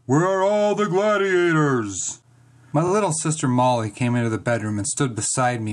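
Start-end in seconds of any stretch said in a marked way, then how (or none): 0.0-2.2 slow, higher, demanding grumble; grumbling god. A narrator's reading. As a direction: call out slowly as an impatient god. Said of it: "he grumbled".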